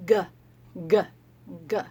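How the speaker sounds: short throaty g sounds, like a gorilla; letter sound g. Shown as a demonstration. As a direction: clip each "G" short in the throat. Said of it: "when he peels his banana, he says".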